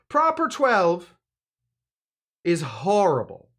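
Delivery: put-on high, loud, drawn-out Irish accent; big mock Irish accent, pitched high. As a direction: declare it in a hammed-up Irish accent. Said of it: "I'm gonna say it in my real accent".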